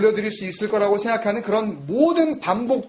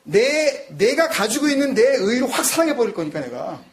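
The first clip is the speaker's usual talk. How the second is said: usual speaking voice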